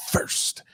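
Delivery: first whispered with weight; stress on first. Shown as a demonstration. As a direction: breathe out first, leaning on it. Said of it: "Emphasis on the".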